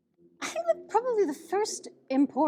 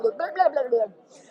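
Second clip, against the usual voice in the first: tongue-tied babble; 3 semitones higher, 6 dB louder, slower than usual. Shown as a demonstration. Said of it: "I'm losing it already".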